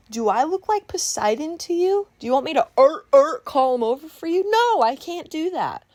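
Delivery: high, loud, incredulous comeback; sarcastic retort. Retold or imitated. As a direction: throw the absurd request back, high and indignant. Retelling sarcastic retort to customer.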